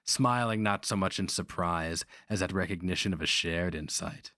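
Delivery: plain reading voice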